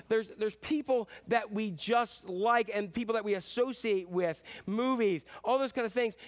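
ordinary talk, nothing marked

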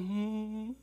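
soft, drawn-out "hmm"; mock-placid humming; mellow serene hum. Preacher mocking a placid Jesus, soft hum. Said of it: "That's not Jesus going".

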